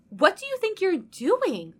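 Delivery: snapping. Acted. Lumine catching Inessa greeting Ajax, snapping. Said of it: "Lumine snaps".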